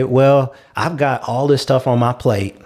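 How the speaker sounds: ordinary delivery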